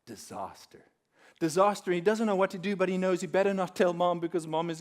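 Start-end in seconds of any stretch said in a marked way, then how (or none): 0.1-0.8 low, hushed dismay; a quiet, dropped disaster; stunned murmur. Acted. Dead bunny found, stunned. 1.4-1.9 louder, higher alarm; mock panic, raised pitch; alarmed cry. Repeats word with alarm.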